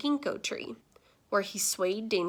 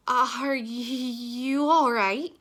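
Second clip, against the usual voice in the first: slow, hesitant, stammered question; shy; 3 semitones higher, 3 dB louder, much slower than usual. A narrator's reading. Alexis asks the fallen pizza man, shyly. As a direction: ask it shyly, stretching the words. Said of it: "she asked shyly".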